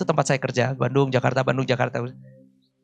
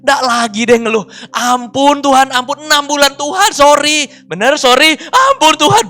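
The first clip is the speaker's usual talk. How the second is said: high, loud retold plea for mercy; mock tearful begging; 9 semitones higher, 7 dB louder than usual. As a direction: act out wailing apologies to God. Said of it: "Sampai berlutut nangis-nangis". (pura-pura meratap minta ampun)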